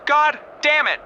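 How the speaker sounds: angry shout. Acted. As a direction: yell it in frustration. Told of five hours' work.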